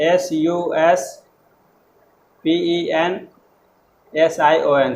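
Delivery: slow letter-by-letter spelling of suspension. Shown as a demonstration. Teacher dictating the correct spelling to students. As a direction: spell एस यू एस पी ई एन एस आई ओ एन, one letter at a time. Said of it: "इसका spelling होता है".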